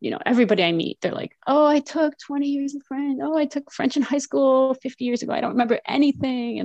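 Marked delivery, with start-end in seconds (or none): 1.4-6.6 higher-pitched mock voice of other people; mimicked excuse-makers. Teacher mimicking everybody she meets about school French, high mock-regretful voice.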